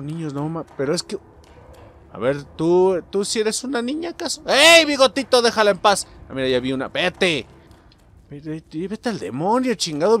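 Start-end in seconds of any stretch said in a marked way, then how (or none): 4.5-6.1 loud high call to back off; yelling at enemy. Real, not acted. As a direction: shout him away. Le grita al enemigo. (grito al bigotito)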